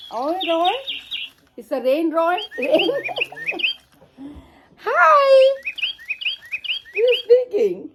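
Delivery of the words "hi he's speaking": put-on high, bright cooing at the baby; high baby talk. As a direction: coo high to the little one. Talking to baby and bird.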